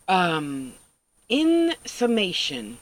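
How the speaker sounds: ordinary talk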